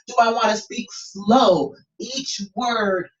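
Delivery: slowing down, drawing out SLOW; slow delivery. Shown as a demonstration. Coach playing with voice for a student, slow delivery. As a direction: stretch "slow" and set each word apart. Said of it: "kind of playing with my voice".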